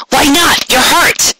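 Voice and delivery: indignant Rainbow. Acted. Rainbow objects to the captain, high and indignant.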